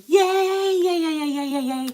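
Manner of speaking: high, loud repeated yays; excited cheering. Real, not acted. Cheering over unboxing.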